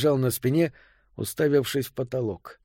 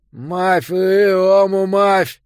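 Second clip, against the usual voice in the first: loud, slow, slurred through a tiny hole; lipless mouth voice; 6 semitones higher, 6 dB louder, much slower than usual. A narrator's reading. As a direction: call out without lips, mushy consonants. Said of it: "сквозь каплевидное отверстие, которое когда-то было его ртом".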